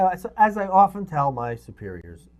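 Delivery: ordinary delivery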